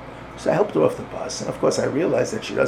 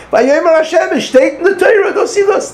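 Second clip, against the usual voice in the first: put-on much higher, loud child's recitation; cheder boy voice; 12 dB louder than usual. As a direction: recite like an eager little schoolboy. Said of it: "the ultimate reality of a Cheder Yingel".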